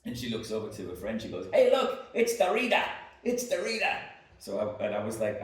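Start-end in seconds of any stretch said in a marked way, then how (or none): 1.5-3.9 very high, very loud mock Boston shout; horrible Bostonian accent. Barmaid calling over to her friend, shouted Boston accent. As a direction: yell it across the bar in a bad Boston accent. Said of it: "my horrible Bostonian accent".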